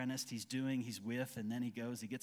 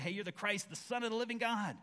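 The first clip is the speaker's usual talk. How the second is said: excited Peter; 8 semitones higher, 4 dB louder than usual. Retold or imitated. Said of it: "Sometimes Peter said".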